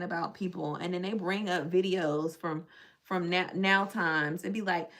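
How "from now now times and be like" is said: normal talking voice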